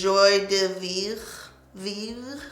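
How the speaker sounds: a light try at a French accent on Joie de Vivre; half-hearted French accent; French accent attempt. Shown as a demonstration. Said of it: "That was my attempt at a French, French accent".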